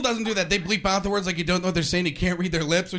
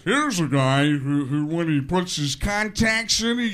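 slow, dragged-out sportscaster voice; hammed-up football commentator impression; John Madden; 3 dB louder, slower than usual. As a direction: explain the obvious ponderously, stumbling on words. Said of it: "Madden actually said this".

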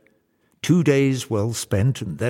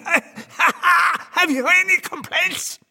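high, slow gleeful cackling taunt; cackle, then crow it very high and drawn out; laughing devil; 4 dB louder, much slower than usual. A narrator's reading. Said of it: "The devil is laughing".

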